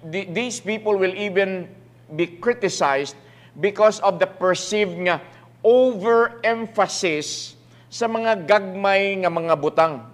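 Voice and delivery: ordinary talk, nothing marked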